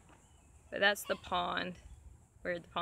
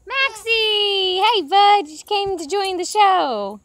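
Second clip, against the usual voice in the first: loud, high, drawn-out greeting to a dog; excited calling out; 12 semitones higher, 17 dB louder, slower than usual. Real, not acted. Calling to the dog as he joins the show, excited calling out. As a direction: holler happily to the dog, voice way up.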